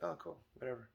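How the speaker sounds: soft, offhand shrug; zero energy. Retold or imitated. Dad shrugging off the coffee request, offhand. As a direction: toss it away like it's nothing. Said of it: "give it zero energy".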